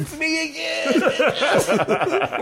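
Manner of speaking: very high, drawn-out laughing shout; gleeful retold whoop; triumphant yell. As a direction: crow it slowly to the crowd. Crows to his stunned group.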